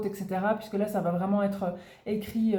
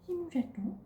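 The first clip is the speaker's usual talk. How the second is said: high, soft cooing; doting over the kitten; 7 semitones higher, 8 dB quieter than usual. Real, not acted. Baby talk to the kitten.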